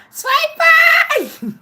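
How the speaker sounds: high squealing "Sweet pea!"; squealed shout. Real, not acted. Greeting a viewer, shrieking "Sweet pea!" with delight.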